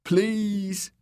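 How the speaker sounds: high, pleading whimper; high, frightened plea. A narrator's reading. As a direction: beg in pain. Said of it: "Dick whimpered".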